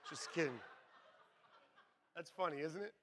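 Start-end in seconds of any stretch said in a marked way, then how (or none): none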